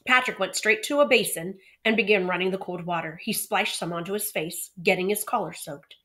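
usual speaking voice

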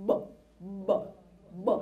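b pulled inward, implosive. Shown as a demonstration. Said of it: "suck the air in".

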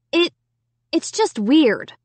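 hesitant, slow false start; stammering. A narrator's reading. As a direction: stumble on the first word, then drag it out. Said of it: "I stammered".

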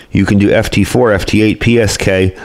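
ordinary delivery

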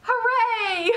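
very high, jubilant hooray; yelled cheer. A narrator's reading. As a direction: cheer like a whole delighted crowd. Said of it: "yelled the crowd".

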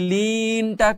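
লিনটা with লি drawn out; stretched vowel. Shown as a demonstration. Teacher pointing out the madd, লিনটা slowly stretched. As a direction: linger on the লি of লিনটা. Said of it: "টান হবে তিন আলিফ".

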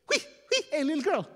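high-pitched hooting call; flirty hailing.

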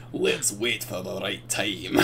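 a hammed-up conspirator biding his time; scheming low voice. Scheming twin plotting revenge.

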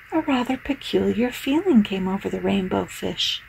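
ordinary delivery

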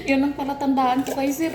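normal talking voice

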